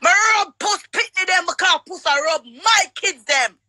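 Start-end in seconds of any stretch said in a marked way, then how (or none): none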